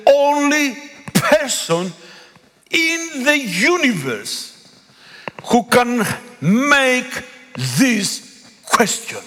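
very slow, each word weighed; slow emphasis. Real, not acted. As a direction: drag it out to press the point home. Preacher stresses Jesus' uniqueness.

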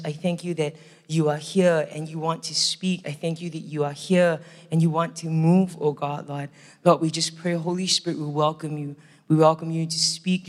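low, softened praying voice; prayer. Real, not acted. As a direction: pray gently and evenly, pitched under normal talk. Leads an opening prayer.

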